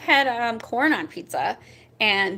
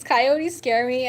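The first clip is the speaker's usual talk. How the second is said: normal talking voice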